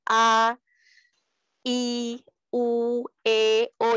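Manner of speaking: A, I, U, E, O each held separately, mouth wide open; clearly articulated vowels. Shown as a demonstration. Teacher showing pupils articulation, clearly articulated A, I, U, E, O. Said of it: "dibuka mulutnya".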